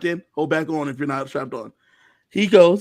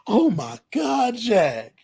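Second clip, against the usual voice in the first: higher, drawn-out overjoyed cry; mock tearful joy; 6 semitones higher, much slower than usual. Ally hears the plan, imitated as overjoyed and tearful. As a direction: imitate being moved nearly to tears. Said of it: "She starts crying a little bit".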